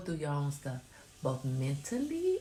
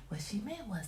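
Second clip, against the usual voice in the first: whispered aside; whispering; 4 semitones higher, 8 dB quieter than usual. Real, not acted. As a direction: whisper it privately.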